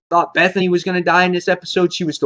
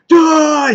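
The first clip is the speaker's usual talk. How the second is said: sharp, higher, louder shout of a gunshot; gunshot yell; 12 semitones higher, 6 dB louder than usual. Retold or imitated. Recapping Rick firing, blurts a loud gunshot cry. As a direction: blurt it out like a shot going off. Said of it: "He's like".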